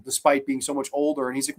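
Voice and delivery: ordinary delivery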